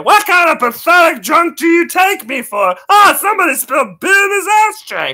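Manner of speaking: high, loud indignant shout; cartoon drunk. Barfly protesting to the bartender, high loud shout. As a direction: bellow like an offended cartoon barfly.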